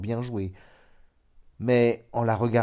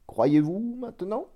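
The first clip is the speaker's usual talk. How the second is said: doctor's question; 11 semitones higher than usual. A narrator's reading. Doctor asks, smiling.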